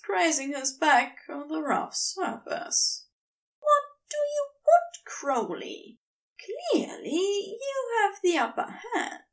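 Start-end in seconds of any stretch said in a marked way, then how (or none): 3.6-9.2 much higher, strained and near tears, rising to a cry; exasperated angel. A narrator's reading. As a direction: plead and protest at once. Aziraphale voiced by narrator.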